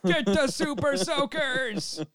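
high, slow, drawn-out mock alarm; mock urgent call. Teller calling for super soakers, high and slow. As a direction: yell it like a silly emergency. Joke call for water guns.

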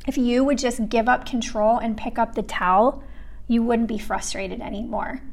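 soft, hushed reply that sinks into a whisper; quiet voice of Jesus. Retold or imitated. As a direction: say it gently, almost under your breath.

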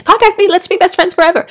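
high, loud, over-keen burst; mock overeager gushing. Overeager new friend begging a stranger to keep in touch, mock gushing. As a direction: gush like an instant best friend.

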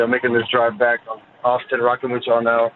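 ordinary delivery

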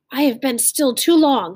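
half-acted shout of desperation. Henry bursting out at his friend, a mild acted shout. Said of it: "Henry shouted".

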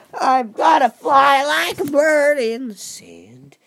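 high, loud, drawn-out silliness; silly put-on voice. Vlogger in nonsense ramble about asparagus, high slow silly voice. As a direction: send it up high and stretch it.

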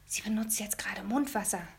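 a breathy aside for one listener only; boy whispering to the new neighbour at the door. Acted. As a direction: whisper it so mother can't hear. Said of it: "flüsterte ich dem Bühl zu".

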